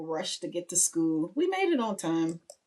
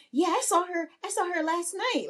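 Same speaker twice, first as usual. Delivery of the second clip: high-pitched imitation of a child's brag; high sing-song mock boast; boasting little boy; 12 semitones higher than usual. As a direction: voice a smug kid, pitched well up. Son boasts to sister, retold.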